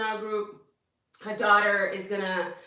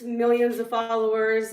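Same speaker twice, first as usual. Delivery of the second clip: usual speaking voice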